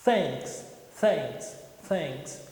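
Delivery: "Thanks" with the tongue between the teeth and a breath of air; "Thanks" blown out through the teeth, no T sound. Shown as a demonstration. Teacher demos th with the tongue between the teeth, blowing. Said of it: "Então vamos lá".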